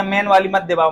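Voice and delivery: ordinary talk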